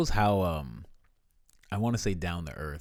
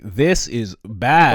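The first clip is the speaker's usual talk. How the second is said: slow, heavy, louder mock warning; mock grave agent; 5 semitones higher, 13 dB louder, much slower than usual. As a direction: drag it out like a grim government agent. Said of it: "they're just like".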